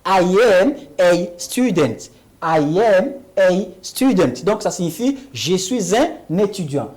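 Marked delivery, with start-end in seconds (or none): 0.0-4.4 lentement, en appuyant sur am; lent et articulé. Shown as a demonstration. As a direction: articule pour que la classe répète. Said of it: "on dira". (démonstration lente de professeur)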